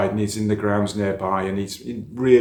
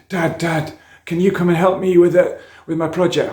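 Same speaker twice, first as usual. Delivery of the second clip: put-on higher, louder pleading kid; eager child; 8 semitones higher, 9 dB louder than usual. Son asking dad for help with his project, eager and loud. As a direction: call out to dad like a keen child.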